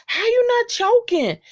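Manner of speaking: shrill mock amazement at the eater; high squeaky disbelief. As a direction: squeak it out like you can't believe it. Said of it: "And I'm like".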